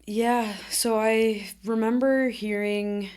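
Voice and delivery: ordinary delivery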